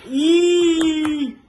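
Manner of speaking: loud happy squeal; excited squeal. Real, not acted. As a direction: squeal for the camera.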